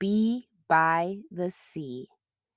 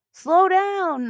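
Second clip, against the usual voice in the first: high, loud, laughing call; amused mom calling to eager child; 11 semitones higher, 7 dB louder than usual. Acted. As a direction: laugh as you call after her. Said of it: "laughed Mom".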